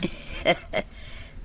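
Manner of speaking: soft breathy laugh. Acted. Mother chuckling softly at her son's answer, quiet airy laugh.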